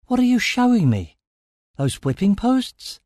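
high-pitched, incredulous questions; alarmed questioning. A narrator's reading. Narrator questions Stellos about the posts, alarmed.

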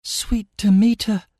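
high-pitched, understated dread; dismayed murmur. A narrator's reading. Amira looks up at the storm, dismayed murmur. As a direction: invoke the goddess in dismay. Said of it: "she murmured".

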